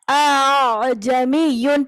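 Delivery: اَأْعْجَمِيٌّ with the first hamza firm and the second softened, slow; slow Quranic recitation demo; tasheel recitation. Shown as a demonstration. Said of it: "دوسرے والے کو مرج کر دیں گے".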